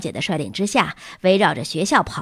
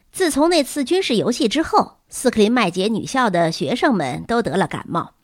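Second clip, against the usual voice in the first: usual speaking voice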